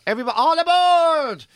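high, loud, long boarding shout; boatman's call. Retold or imitated. Boatman calling passengers to board, imitated shout. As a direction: call out across the water to passengers. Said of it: "Fiat Punto floating just goes".

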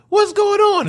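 surprised high. Retold or imitated. Belle finds her door locked.

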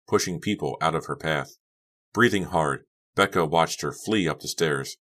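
ordinary delivery, nothing marked

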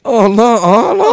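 high, loud wailed "oh no"; mock dismay. Friend reacting to the smoking plan, loud mock dismay. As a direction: cry out in exaggerated alarm.

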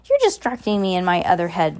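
ordinary delivery

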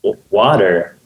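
low, put-on American "water"; American accent. Shown as a demonstration. Repeating it on request, exaggerated American accent. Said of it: "so American".

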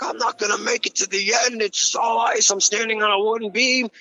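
high, panicked cry; retelling his terror on the icy jetty, panicked and high; freaked out. Retold or imitated. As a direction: voice jumping up in panic. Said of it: "I freaked the fuck out".

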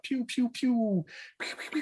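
high, soft laser pews; blaster fire noises. Retold or imitated. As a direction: imitate a toy ray gun.

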